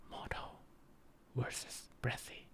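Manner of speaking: soft unvoiced whisper. Shown as a demonstration. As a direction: whisper the words, no voice. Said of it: "like if I were to whisper".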